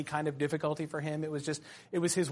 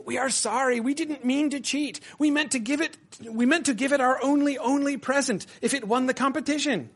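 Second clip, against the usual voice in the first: higher, whiny pleading creature voice; pleading Gollum; 7 semitones higher than usual. Acted. Narrator voicing Gollum begging Bilbo's pardon, high and pleading. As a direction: beg like a sorry little creature. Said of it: "He kept on saying".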